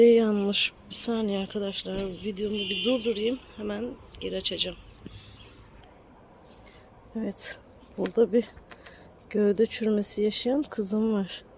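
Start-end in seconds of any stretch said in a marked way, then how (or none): none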